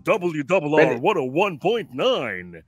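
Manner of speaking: slow, drawn-out radio station ID, imitating a young wannabe DJ; over-modulated DJ voice. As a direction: puff up the voice and modulate every word to the hilt. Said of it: "nagpapalaki ng boses, yung todo modulate".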